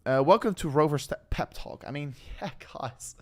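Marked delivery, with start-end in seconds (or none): none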